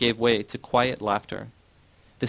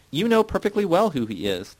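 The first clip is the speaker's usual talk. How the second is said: high, raised-voice indignation; indignant; 8 semitones higher, 4 dB louder than usual. A narrator's reading. Titus challenging the narrator's denial, indignant. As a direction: throw the accusation out, pitched well up. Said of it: "Titus said indignantly".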